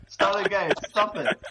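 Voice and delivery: can't hold back the giggles; breaking character giggling. Real, not acted. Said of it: "I'm terrible for just corpsing".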